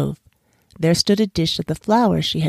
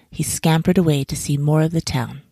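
ordinary delivery

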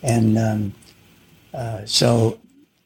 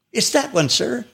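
eager borrower; 11 semitones higher than usual. Acted. Borrower answers the banker.

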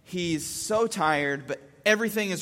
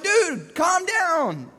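high, emphatic plea to calm down; retold frustration with a small child; exasperated dad; 11 semitones higher, 4 dB louder, much slower than usual.

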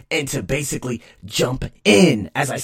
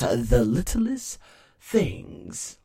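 usual speaking voice

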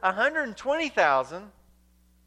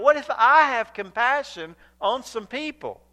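raised, forceful voice with weight on "I"; higher and louder, pressing "I" against the listener; emphatic; 6 semitones higher, 5 dB louder than usual. Acted. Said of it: "and then he emphasizes himself".

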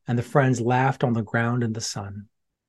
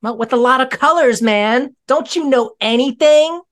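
sassy; 11 semitones higher, 6 dB louder than usual. Real, not acted. Child mocking the adult.

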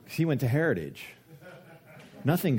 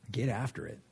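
normal talking voice

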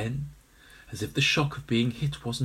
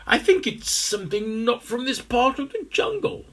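high musing voice; 11 semitones higher, 3 dB louder than usual. A narrator's reading. Ambrose guesses.

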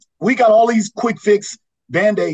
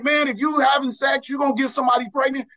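high, raised coach's warning delivered fast; stern warning; 7 semitones higher than usual. Retold or imitated. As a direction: scold the players ahead of time. Coach warning players before.

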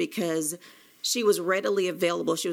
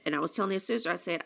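ordinary talk, nothing marked

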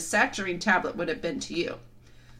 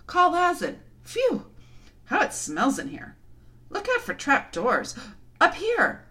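high excited calls; crying out; 9 semitones higher than usual. Acted. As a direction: shout from room to room. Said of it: "voices crying out at once".

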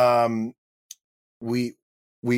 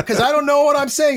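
very high, louder, flustered; frantic excuse; 14 semitones higher, 5 dB louder than usual. Retold or imitated. Actor flustered answering the director, high and frantic. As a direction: squeak it out, caught off guard.

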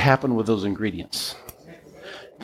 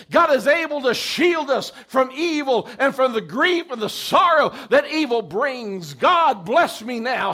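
rising, shouted sermon build; preaching at full force; 13 semitones higher than usual. Acted.

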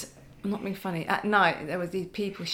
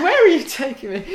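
laughing retelling; 6 semitones higher, 7 dB louder than usual. Retold or imitated. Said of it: "It's like".

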